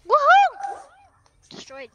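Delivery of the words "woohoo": high loud cheer; real victory yell; excited whoop. Real, not acted. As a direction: whoop with joy. Celebrating a win.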